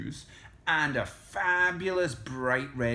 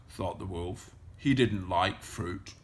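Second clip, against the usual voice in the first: ordinary delivery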